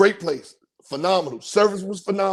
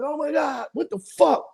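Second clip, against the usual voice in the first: panicked; 8 semitones higher than usual. Retold or imitated. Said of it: "I'm like".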